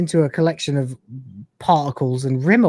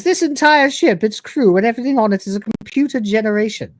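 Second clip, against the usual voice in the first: higher, lightly imitated regal English accent; fake regal accent; 6 semitones higher than usual. Hostess explaining her ship to Rimmer in a mock regal accent. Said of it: "almost regal accent".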